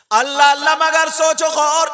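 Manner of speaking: loud, high exhortation; full-force preaching. Real, not acted. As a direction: cry out to the congregation.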